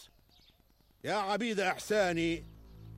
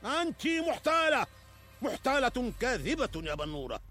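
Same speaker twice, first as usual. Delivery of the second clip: angry; 4 dB louder than usual. Acted. King accuses the fortune teller.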